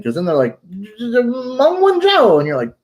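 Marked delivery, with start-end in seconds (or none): 0.7-2.4 high, loud blurted name; mock outraged cry. Locals confronting a supposed Canadian, mock outraged cry. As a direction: imitate an outraged accuser shouting. Acting out locals' hostile reaction.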